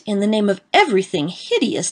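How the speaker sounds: plain reading voice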